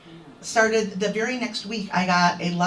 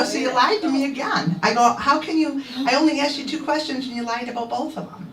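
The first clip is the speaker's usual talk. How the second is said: indignant confrontation; 6 semitones higher than usual. Retold or imitated. Retold confronting a liar.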